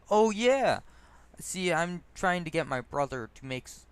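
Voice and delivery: a loud, high "Oh, yeah", then running on; a drunk delighted to be corrected, bursting out; excited drunk. Retold or imitated. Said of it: "the drunk gestures".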